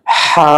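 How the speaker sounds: "হা" squeezed from the middle of the throat; constricted Arabic "হা". Shown as a demonstration. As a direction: push a held "হা" through a tightened throat. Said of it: "কণ্ঠনালীর মাঝখান থেকে হা উচ্চারণ হবে".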